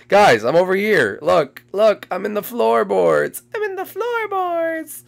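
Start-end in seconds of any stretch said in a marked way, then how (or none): 3.6-5.0 high, slow teasing call; sing-song taunt. Pretend. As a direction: tease them in a high, drawn-out singsong. Teasing seekers from hiding spot.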